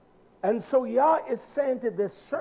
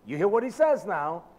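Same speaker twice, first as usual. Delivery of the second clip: ordinary delivery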